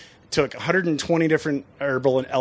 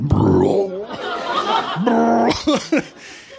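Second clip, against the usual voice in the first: comic mock puking sound; imitated vomiting; 10 semitones higher than usual. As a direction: mimic a man throwing up out back. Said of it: "all I hear is just, like".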